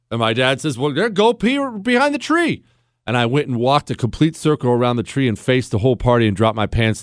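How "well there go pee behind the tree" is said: lightly voiced dad. Dad quoted in story.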